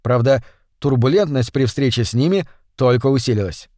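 ordinary delivery, nothing marked

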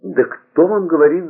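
high, agitated protest. A narrator's reading. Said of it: "заволновался Вадрель". (взволнованно, высоким голосом)

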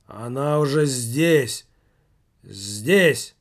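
slow, loud, urgent; the spirit is here, say it with awe; urgent announcement. Acted. Medium announcing spirit's arrival.

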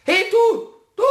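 loud, high call to wake someone. Acted. Pumuckl yells at the sleeping cat, loud high call. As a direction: shout up at the cat to rouse it.

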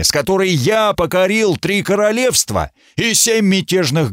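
furious monarch, pitched high and hard; roaring tyrant king. Acted. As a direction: rage at the duke like a tyrant. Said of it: "рявкнул король". (ревущий король-тиран)

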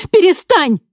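high desperate scream; shout. A narrator's reading. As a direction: yell at him to stop. She screams to stop him.

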